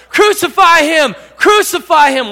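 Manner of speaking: loud, high mob chant; angry crowd shouting. Acted. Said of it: "people that were in the crowd going".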